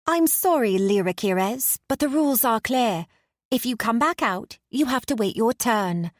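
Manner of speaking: a polite rule-keeping satyr stopping the heroine. Acted. Satyr blocks her path.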